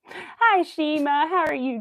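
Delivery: high, loud, bright hello; excited greeting. Real, not acted. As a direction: call out a happy hi to a viewer. Greeting a chat viewer.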